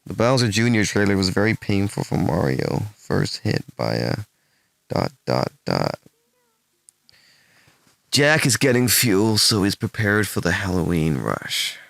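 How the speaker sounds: put-on American accent, measured read. Shown as a demonstration. Streamer reading posts aloud as a test, careful American accent. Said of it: "can you do your best American accent".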